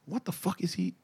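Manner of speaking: hushed retold disbelief; baffled aside. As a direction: mutter it under your breath, bewildered. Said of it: "I'm like".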